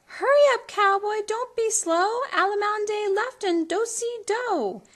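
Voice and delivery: Cindy calling to Joe; Cindy calling the dance. A narrator's reading. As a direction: tease the cowboy along.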